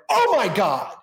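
high, startled retold exclamation; flustered. Co-star startled by his new son-in-law, flustered. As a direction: mimic his stunned, flustered reaction. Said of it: "like, flustered".

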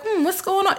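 high, innocent curious coo; sweet curious. Retold or imitated. Villagers humouring the policeman, high and sweet. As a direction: play the polite villager, lightly sweet. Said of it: "they're not as rude to him".

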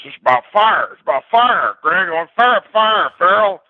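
high, driving coach chant; coach yelling fire. Retold or imitated. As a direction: hammer it like a coach riding a player. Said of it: "he's riding me in a practice".

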